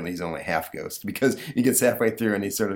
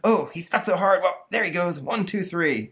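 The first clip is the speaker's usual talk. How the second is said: put-on straining boy voice; 10 semitones higher than usual. Stanley pulls the ghost free.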